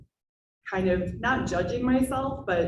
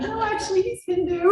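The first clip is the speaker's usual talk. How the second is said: amused reply; 12 semitones higher than usual. Retold or imitated. Retold punchline reply.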